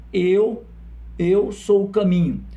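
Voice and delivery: slow, emphatic proclamation; Jesus's declaration. Retold or imitated. Preacher setting Jesus against the prophets, slow forceful declaration. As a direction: hold the first word, pause, then declare it. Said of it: "Mas Jesus diz".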